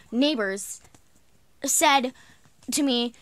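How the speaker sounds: ordinary talk, nothing marked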